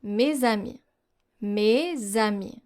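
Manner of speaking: mes amis with a z sound linking into amis; French liaison. Shown as a demonstration. Teacher demonstrating to learners, mes amis linked with z. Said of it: "For example".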